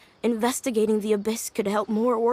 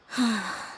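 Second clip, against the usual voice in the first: wistful sigh; 3 dB quieter than usual. Acted. Plant has not bloomed.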